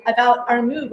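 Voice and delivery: ordinary talk, nothing marked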